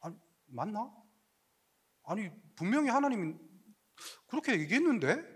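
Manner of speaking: quiet, puzzled self-talk; John doubting under his breath; confused muttering. Retold or imitated. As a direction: wonder aloud to yourself.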